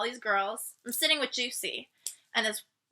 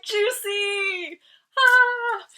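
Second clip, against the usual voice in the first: higher, drawn-out delighted name; happy reunion cry; 10 semitones higher, 6 dB louder, much slower than usual. Retold or imitated. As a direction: call her name with glee. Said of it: "I'm like".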